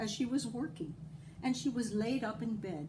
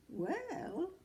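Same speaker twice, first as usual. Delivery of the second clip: high little leprechaun voice; sly high-pitched leprechaun; high leprechaun drawing out well; 12 semitones higher than usual. A narrator's reading. Leprechaun voice continues.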